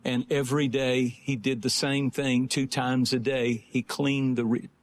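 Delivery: low-pitched, measured testimony with long pauses; sober sermon storytelling. Real, not acted. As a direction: tell the story gravely, pausing between phrases. Tells illustrative story in sermon.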